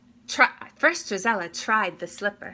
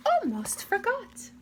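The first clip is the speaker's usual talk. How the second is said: high fluttery fairy voice; high and flustered; high fairy godmother catching herself; 12 semitones higher than usual. A narrator's reading. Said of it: "cried the fairy godmother".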